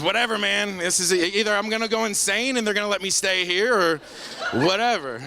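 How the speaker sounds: high, loose throw-up-your-hands delivery; giddy resignation. Retold or imitated. Patient deciding to laugh along with his friend, giddily resigned. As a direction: give up, high and exasperated.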